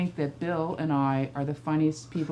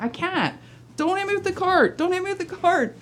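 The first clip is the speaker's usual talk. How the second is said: higher and louder each time, pleading; mock panicked plea; 12 semitones higher, 3 dB louder than usual. Mother pleading with her son pushing the cart, higher and louder. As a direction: keep rising in pitch with every repeat. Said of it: "I'm like".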